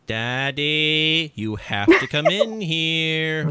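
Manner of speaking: put-on high, slow kid voice; drawn-out singsong summons; twins calling dad. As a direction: imitate a child calling from the bathroom. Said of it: "just in that tone of voice".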